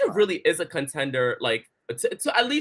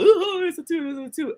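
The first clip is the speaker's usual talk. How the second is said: mocking gush; 13 semitones higher than usual. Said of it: "everyone was".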